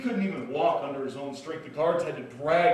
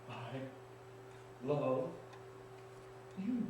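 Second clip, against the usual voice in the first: gentle voice; 2 semitones lower, 12 dB quieter, much slower than usual. Acted. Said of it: "That voice kept going through my head".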